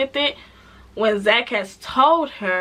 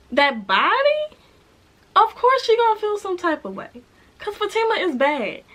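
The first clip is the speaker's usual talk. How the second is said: playful emphasis; 8 semitones higher than usual. Real, not acted. Admiring Fatima, voice shifts high.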